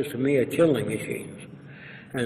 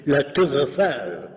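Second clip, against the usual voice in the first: slow, demonstrative French pronunciation; put-on French accent; 3 dB louder than usual. Shown as a demonstration. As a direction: say it as a Frenchman would. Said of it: "If he's brought up in France".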